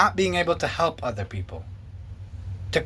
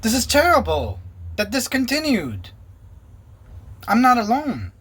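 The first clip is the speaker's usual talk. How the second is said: higher, urgent retold dismay; a rush of alarm in the retelling; high alarmed realization; 7 semitones higher than usual.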